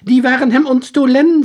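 put-on high, loud, bewildered old soldier. Soldier pleading his story to the detective, high and loud. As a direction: pitch him up high and loud as the lost soldier.

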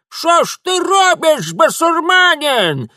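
piercingly high, slow, loud yell; frantic wail. A narrator's reading. Old woman sees Mitya break her lock, frantic yell. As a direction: howl in outrage as an old woman. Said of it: "истошно завопила бабка".

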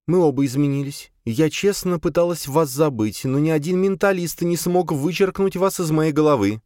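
put-on high, tearful young woman; Sofia's voice. A narrator's reading. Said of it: "Софья подняла на меня влажные глаза". (высокий женский голос со слезой)

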